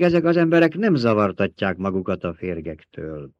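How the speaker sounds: ordinary delivery, nothing marked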